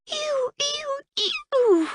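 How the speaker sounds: very high squeal of disgust. A narrator's reading. Princess who stepped in a cow pie, squealing. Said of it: "hopped up and down on one foot and squealed".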